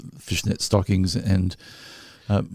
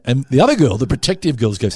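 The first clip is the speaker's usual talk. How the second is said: normal talking voice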